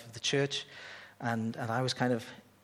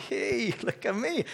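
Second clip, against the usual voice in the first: mock show-off; 8 semitones higher, slower than usual. Mocking a show-off attitude.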